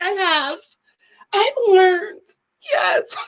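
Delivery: high, overcome crying voice; mock tearful answer. Tage answering dad's question, mock tearful. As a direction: answer as if choking up, hammed-up.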